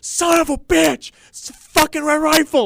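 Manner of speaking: high, drawn-out cursing wail; re-enacted sports-fan meltdown; retold outraged yell. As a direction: wail it in high-pitched disbelief, dragging the words out. Retells his outburst at the television.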